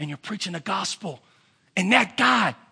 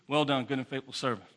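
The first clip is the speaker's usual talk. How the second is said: a soft, lifeless "Well done"; flat, dull reading; 7 semitones lower, 7 dB quieter than usual. Shown as a demonstration. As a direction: read it quietly with no feeling at all. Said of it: "like I did and say".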